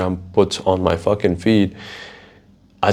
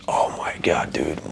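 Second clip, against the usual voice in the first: soft, lower, breathy hush; spooked whisper; 5 semitones lower, 7 dB quieter than usual. Real, not acted. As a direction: murmur in disbelief. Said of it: "feeling cringe or just fucking scared".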